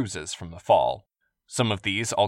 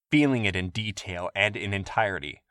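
plain reading voice

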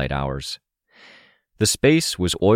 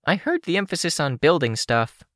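higher female character voice; lightly voiced young woman; Kenzie voice; 12 semitones higher than usual. A narrator's reading.

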